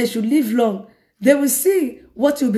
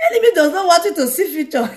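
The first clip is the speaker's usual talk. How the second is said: higher, quicker, breaking into laughter; real laughing; 6 semitones higher, faster than usual. Real, not acted. Preacher rebutting that prayer to the congregation, laughing. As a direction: exclaim it and crack up. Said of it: "I don't know why I'm laughing".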